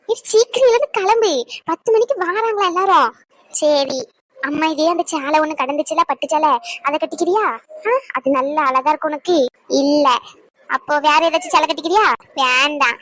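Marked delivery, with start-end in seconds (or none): none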